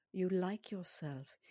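put-on slow, possibly South African accented recording voice; imitated foreign-accented meditation guide; hypnotist's foreign accent. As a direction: lull them in a measured, accented hypnotist voice. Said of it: "and she has an accent".

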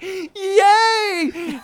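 high, hammed-up "yay"; mock cheer. Podcaster mimics the kids finding Stardust, gleeful. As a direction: squeal a big pretend hooray.

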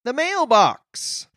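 high, slow grand reveal; dramatic announcer. Acted. Host announcing the mailbox segment with slow dramatic flair. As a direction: draw out the title like a showman.

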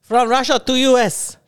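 put-on Russian accent. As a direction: read it like a Russian ad. Mocking Russian realtor ad.

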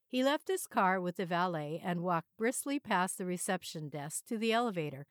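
usual speaking voice

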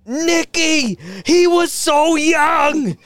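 high, drawn-out melodramatic lament; hammed-up wail over a fallen pal; mock grief. Mobster runs out to friend in the rain, mock lament.

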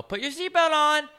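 high, loud bus driver order; squeaky mock bus driver. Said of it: "she would go".

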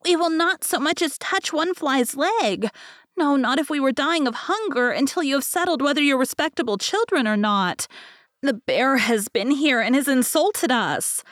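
high, indignant chick voices; upset young wrens. A narrator's reading. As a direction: protest like offended baby birds. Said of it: "continued to cry and scream".

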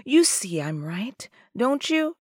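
soft, half-whispered farewell; holding back tears. Acted. Tearful woman saying goodbye to her lover, controlled sorrow. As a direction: fight down tears, barely voicing it. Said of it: "Drowning the smile came tears".

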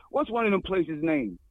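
normal talking voice

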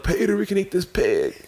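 mock Clinton calling Peter; Bill Clinton impression. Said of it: "my Bill Clinton voice".

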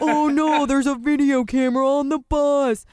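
high, loud panicked cry; loud panicked movie voice. Retold or imitated. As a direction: shout it in panic. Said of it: "they're like".

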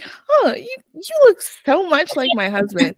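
high, airy, oblivious wife; airy clueless wife. Retold or imitated. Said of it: "she's like".

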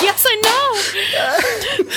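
high, drawn-out mock crying; slow, high, laughing fake sob; mock wail. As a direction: wail it in comic despair, half laughing. Acting out own reaction to criticism.

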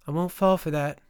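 mildly huffed refusal; huffy. A narrator's reading. Captive Faye refusing to be tricked, mildly huffy. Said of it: "Faye huffed".

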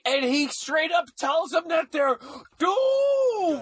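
high, slow dramatic shouting. Real, not acted. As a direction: cry it out like a carnival barker. Hyping the doom prophet.

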